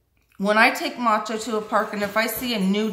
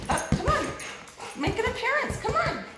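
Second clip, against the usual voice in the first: high, soft sing-song call to a pet; coaxing the dog; 14 semitones higher, 6 dB quieter than usual. Real, not acted. Calling her dog over.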